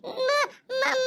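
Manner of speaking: mock-tearful stutter of a little girl; a hinted sob while she starts to speak; light sob before she speaks; stammering sob. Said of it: "इतनी डरी हुई क्यों लग रही है?".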